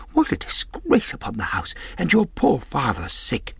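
high-pitched lamenting woman's voice; dismayed mother. A narrator's reading. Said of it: "cried my mother".

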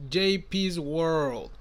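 slow, drawn-out announcer read. Pretend. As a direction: savor each word like a promo. Repite el código en anuncio. (voz de locutor lenta y alargada)

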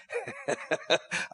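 high, light chuckle; laugh. Real, not acted. Preacher recalling his wife's terrified friend, laughing. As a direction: break into a high giggle.

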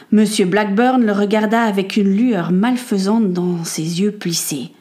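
ordinary delivery

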